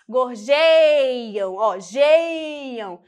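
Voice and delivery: "Gorjeiam" with a long, loud JEI; stress on middle syllable. Shown as a demonstration. Teacher showing the tonic syllable, stressing JEI in "Gorjeiam". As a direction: punch and stretch the JEI in "Gorjeiam". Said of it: "Nossa sílaba tônica é a do meio".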